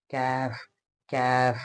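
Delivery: काफ that sounds like कैफ, vowel drifting toward ai; quiet demo of the misheard कैफ; sounds like kaif. Shown as a demonstration. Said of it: "ऐसा महसूस हो कि मैं पढ़ रहा हूँ". (कैफ जैसा सुनाई देता काफ)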